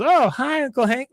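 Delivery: put-on high, chirpy girl voice; Luann voice. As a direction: chirp it cheerfully.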